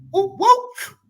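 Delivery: sudden high whooping call. Real, not acted. Streamer whooping at critics to bring it on. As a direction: hype yourself up with a high whoop. Hyping a fight, calling out.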